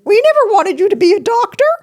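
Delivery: high, loud put-on parent voice; hammed-up high parental protest; mock parent voice. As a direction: squeal it like an overeager mom and dad.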